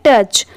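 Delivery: टच as one syllable ending in "ch"; pronunciation demo. Shown as a demonstration. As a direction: say टच short, no added "es". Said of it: "इसे आप सिर्फ क्या पढ़ेंगे". (टच को एक ही ध्वनि में पढ़ें)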